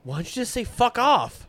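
fuck off jumps up high and loud; exasperated. Real, not acted. Baffled by the posh reviewer's phrase, loud and exasperated. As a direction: blurt the fuck off in disbelief.

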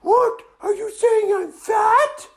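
very high, loud outraged squeal; offended girl dragon. Acted. Dragon takes offence at the kids, high indignant cry. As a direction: demand it like an insulted girly dragon. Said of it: "And she said".

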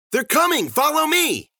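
high, loud warning shout; alarmed high holler; urgent cry. A narrator's reading. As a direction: yell for them to follow. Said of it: "Susie cried".

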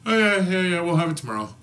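high, breezy store clerk; chirpy counter guy, no problem; cheery clerk voice. Retold or imitated. Imitating cheerful parts clerk.

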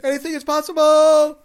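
roaring victory cry after the big win; Kevin Garnett. Retold or imitated. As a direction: yell it like you just won the title. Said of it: "I always think of Kevin Garnett".

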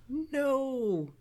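high-pitched mock refusal; whining guy. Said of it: "this guy's like".

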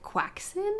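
"Quaxin" very high with a rise; mocking Quaxin. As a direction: ask "Quaxin" in shrill disbelief. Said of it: "in a certain way".

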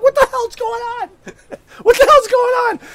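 high, loud bewildered shout; laughing what the hell shout; baffled yell. Retold or imitated. Retold baffled outburst.

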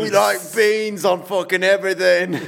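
high, exaggerated mock British; British accent. Host joining the guest's beans joke, high mock British accent. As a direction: join in with a silly British accent. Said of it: "you better pick up an accent".

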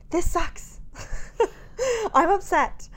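high, loud, half-crying rant; venting breakdown. Retold or imitated. Mom venting to her husband about covid isolation, upset and near tears. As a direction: let it all spill out, near tears. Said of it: "I was just like".

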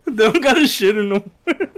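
laughing through the words. Real, not acted. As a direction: speak while cracking up. Laughing at toilet story.

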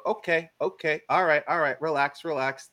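retold nervous appeasing; mock placating. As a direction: talk down an armed cop, acted out. Placating an armed cop.

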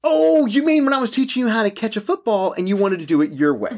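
high, laughing protest; playfully outraged comeback; mock indignant. Real, not acted. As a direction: push the blame back, amused and high-voiced. Teasing protest at being blamed.

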